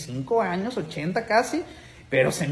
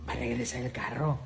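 stunned quiet echo; 6 semitones lower, 9 dB quieter than usual. Retold or imitated. Driver stunned by her plan.

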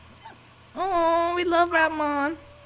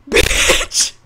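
breathy outburst; 9 dB louder than usual. Real, not acted. Reacts to the mess.

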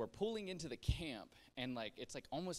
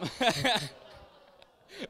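high, loud burst of laughter; 10 semitones higher, 13 dB louder than usual. Real, not acted. Laughing at his Antichrist joke about the teacher, loud. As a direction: crack up at his own joke. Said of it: "no, no, no, no".

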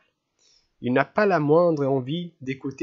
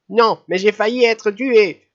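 put-on indignant old lady; 8 semitones higher, 6 dB louder than usual. A narrator's reading. Old lady complains to Marianne.

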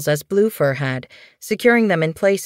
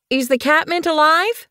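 high, raised voice calling down; 12 semitones higher than usual. Acted. Bluefur calling down to the medicine apprentice, raised and high. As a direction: call across the clearing to be heard. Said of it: "she called".

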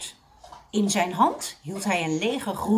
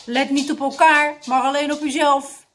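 loud, raised instructor's order; shouted command; 5 semitones higher, 8 dB louder than usual. A narrator's reading. Dictator scolding his exercising seniors, shouting. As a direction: bark at the whole gym class. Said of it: "riep dictator".

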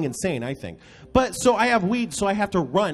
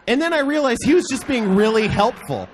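ordinary delivery

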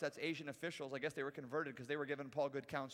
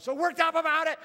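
loud, high mock outrage; mock worked-up; 13 semitones higher, 16 dB louder than usual. Preacher mocking a culture fired up over headlines, mock agitation. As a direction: get overheated about nothing. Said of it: "They're just all worked up".

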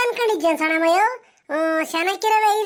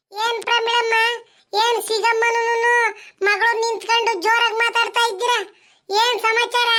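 high, chatty village auntie; curious neighbour calling over the fence; 7 semitones higher than usual. Acted. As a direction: pitch up into the nosy neighbour's voice. Neighbour Sushilamma character voice.

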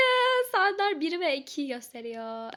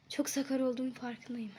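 ordinary talk, nothing marked

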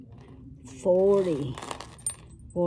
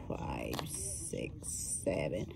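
whispering the numbers under her breath; whispered count; 12 dB quieter than usual. Real, not acted. Counting links for viewers, dropping to a whisper. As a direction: drop to a whisper mid-count.

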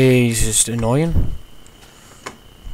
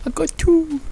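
much higher excited cry; excited squeal. Real, not acted. Player telling the friend he got a second key, thrilled. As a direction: squeal it with glee.

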